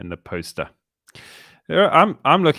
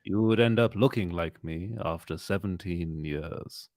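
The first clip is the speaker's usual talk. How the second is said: a hint of an aged, soft old man; lightly voiced weary old Obi-Wan; 3 semitones lower, 5 dB quieter than usual. Host plays the aged Obi-Wan for friends, soft old voice.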